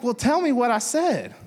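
high-pitched retold plea of a puzzled dad; acted insistent question. Dad asking his daughter what he said, high insistent retelling. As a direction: press for the answer, voice pitched high. Father presses daughter for the word.

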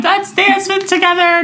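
very high, gleeful mock squeal; playfully hammed-up enthusiasm; excited invitation. Acts out inviting strangers to dance.

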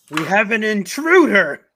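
loud, high joking warning; hammed-up announcement of danger; mock alarm. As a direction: sound a pretend intruder alert. Mock alarm, then just kidding.

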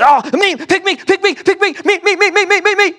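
put-on high, loud kid begging to be picked; eager kids. Preacher imitating classroom kids begging to be picked, high and loud. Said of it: "their hands".

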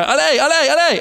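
high, loud French countdown ending in allez; French countdown shout. Retold or imitated. French operator launching the rider with a loud high cry. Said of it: "Boom, boom".

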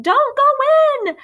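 drawn-out high shout of warning; calling out. A narrator's reading. Girl warns the boy from the window, loud slow shout. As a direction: yell the warning slowly across the street. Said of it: "Phoebe called out".

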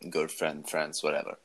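normal talking voice

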